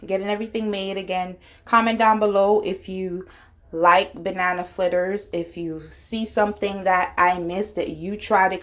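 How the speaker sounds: ordinary talk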